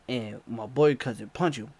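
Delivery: ordinary talk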